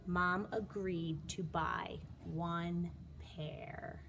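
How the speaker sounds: ordinary delivery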